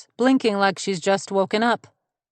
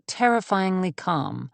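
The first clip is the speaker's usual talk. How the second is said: ordinary delivery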